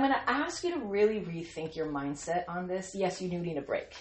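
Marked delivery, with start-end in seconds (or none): none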